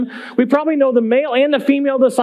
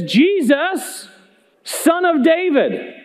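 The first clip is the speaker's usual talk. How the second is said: higher-pitched, stretched-out call; imitated calling out; 5 semitones higher, much slower than usual. Desperate mother calls out to Jesus, imitated calling out. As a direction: draw out the name and title as a plea. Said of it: "She's calling out to him".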